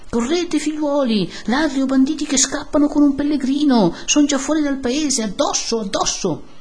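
high-pitched urgent rallying cry. A narrator's reading. Eyewitness rouses villagers after bandits, crying out. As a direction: urge the crowd to give chase.

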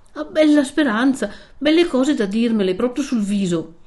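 high, emphatic sarcastic retort; stung, indignant exclamation; bitter indignation. A narrator's reading. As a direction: retort with wounded scorn. Renzo bitterly rebukes her hope.

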